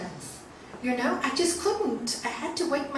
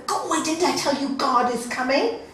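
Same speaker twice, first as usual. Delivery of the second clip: loud, raised-pitch telling-off; angry scolding; 4 semitones higher, 7 dB louder than usual. Acted. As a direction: shoo the dog off crossly. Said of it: "he swears at the dog".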